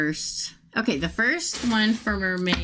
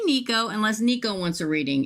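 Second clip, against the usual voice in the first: usual speaking voice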